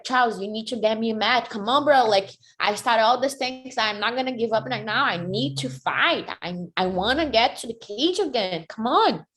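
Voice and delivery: high, urgent retold appeal; insistent pleading. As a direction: badger the manager to act. Acting out pleading with manager.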